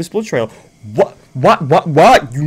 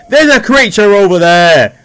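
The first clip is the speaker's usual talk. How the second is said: loud, higher warning cry; alarmed shout; 4 semitones higher, 8 dB louder than usual. Real, not acted. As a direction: yell the warning to your mates. Spotting zombies in game.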